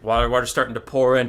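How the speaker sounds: usual speaking voice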